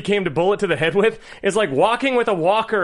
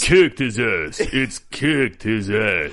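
lightly low Stallone mumble, slow; 8 semitones lower, much slower than usual. Retold or imitated. Fixing the quote, a light Stallone voice, lower. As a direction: correct the line in a mumbled Stallone. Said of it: "your Stallone".

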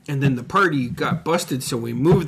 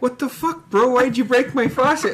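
high-pitched imitation of a baffled friend; mock outraged friend; 10 semitones higher than usual. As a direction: squeak out the friend's disbelief. Voicing friend Ed in story.

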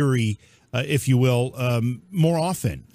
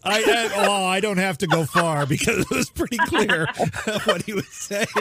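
words bubbling out through laughter; laughing hard; 3 semitones higher than usual. Real, not acted. Host telling guest about a garbled clip, laughing hard. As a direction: explain it while still cracking up. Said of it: "I'm laughing so hard".

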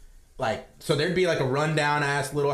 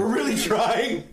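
mocking worker; 7 semitones higher, slower than usual. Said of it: "that's what he told me".

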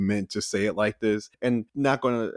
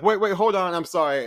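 flustered apology; 8 semitones higher than usual. Retold or imitated. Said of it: "She was like".

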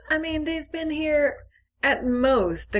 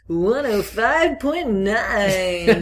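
radio DJ voice; 3 semitones higher, much slower than usual. Pretend. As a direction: drawl it like a late-night DJ. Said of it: "That's not a radio voice".

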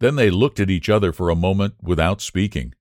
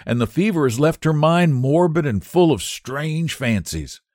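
put-on young Sue, worried; 8 semitones higher than usual. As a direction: plead her friend's case, pitched up. Sue's voice in the story.

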